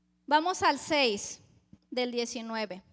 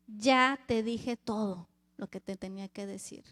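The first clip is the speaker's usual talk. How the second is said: a lightly voiced, lower and softer Jesus; Jesus answering; 6 semitones lower, 3 dB quieter than usual. Preacher voicing Jesus' silent reply to Pilate, soft and low.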